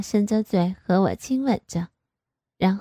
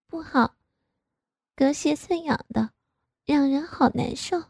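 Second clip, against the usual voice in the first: high, sulky complaint; pouting; 5 semitones higher than usual. A narrator's reading. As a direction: pout and whine it. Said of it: "我撅着嘴". (撅嘴抱怨)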